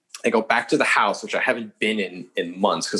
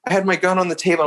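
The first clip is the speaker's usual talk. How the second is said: higher, jittery retold fib to a cop; nervous liar; 8 semitones higher than usual. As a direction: act caught out, a touch flustered. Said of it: "I'm, like, breathing heavily".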